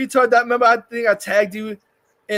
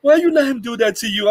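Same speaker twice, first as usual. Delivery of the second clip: higher-pitched mock scolding; imitated indignant girl; 5 semitones higher than usual. Retelling an interviewer challenging the actress, mock indignation. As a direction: lightly mimic an indignant woman interviewer.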